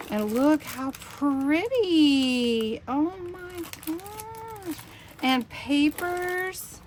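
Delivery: high, slow, choked-up awe; fighting back happy tears; real emotion, voice lifting and slowing; near tears. Real, not acted. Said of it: "I'm trying not to cry".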